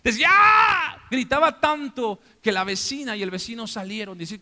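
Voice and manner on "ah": high, drawn-out cry of grief; re-enacted anguished scream; loud wailing scream. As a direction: scream it out at full force. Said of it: "Gritaba, decía".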